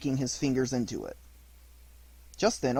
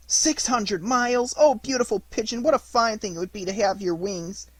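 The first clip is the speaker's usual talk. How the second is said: put-on high, pleading boy's voice full of grief; a lost little puppet begging his dead fairy to come back; weeping puppet boy; 8 semitones higher, 5 dB louder than usual. Pinocchio dismayed then longing.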